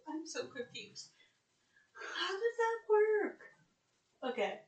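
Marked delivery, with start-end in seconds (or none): 1.9-3.4 high, slow, loud crying-laugh; bewildered wail. Real, not acted. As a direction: whine it out in comic despair. Confused by British words.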